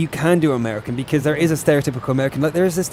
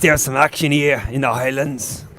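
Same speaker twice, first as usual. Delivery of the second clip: Scottish accent. Shown as a demonstration. Showing co-caster he can do Scottish, put-on Scottish accent. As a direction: do a broken-voiced Scot from the Highlands. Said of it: "I can do Scottish".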